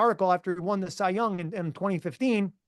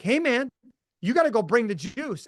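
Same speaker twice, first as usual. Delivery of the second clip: pumped-up hype; 6 semitones higher than usual. Retold or imitated. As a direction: fire him up before he goes on. Hyping up the boring speaker backstage.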